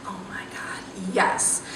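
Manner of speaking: breathless whispered yes; excited whisper. Retold or imitated. Coworker invites her to lunch, she whispers yes. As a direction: whisper it like a secret thrill.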